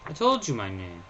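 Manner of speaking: ordinary delivery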